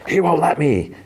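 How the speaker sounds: a trapped ghost child, lightly voiced; retold spooky whisper of a dead boy. Ghostly voice from the drain.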